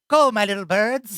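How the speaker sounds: hammed-up sinister spymaster call; Varys. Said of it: "you're like Varys on Game of Thrones".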